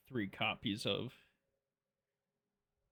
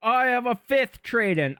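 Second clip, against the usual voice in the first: loud; 10 semitones higher, 10 dB louder than usual. Real, not acted. Frustrated at a duplicate card.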